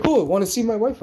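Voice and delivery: high, loud eager voice; high stranger offer. Retold or imitated. Stranger offering to show his wife, high eager voice. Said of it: "And he's like".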